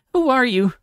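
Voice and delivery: high, loud demand. Acted. Moon demanding the telepathic voice reveal itself, loud high demand. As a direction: insist on an answer, loud. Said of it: "Moon demanded".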